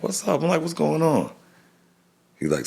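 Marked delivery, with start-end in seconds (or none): 0.0-1.3 high worried question. Retold or imitated. Startled by dad's call.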